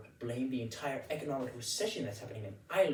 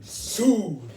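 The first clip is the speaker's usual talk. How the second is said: dramatic outcry; 7 semitones higher, 13 dB louder than usual. Acted. Comic horror at lawsuit news.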